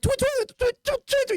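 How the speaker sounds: high, quick squeaky gasps; high, squeaky nervous breaths; sharp inhales. Retold or imitated. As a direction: suck air in little squeaks. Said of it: "so it goes like".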